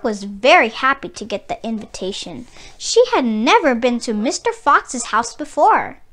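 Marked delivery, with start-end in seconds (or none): none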